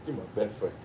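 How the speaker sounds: ordinary delivery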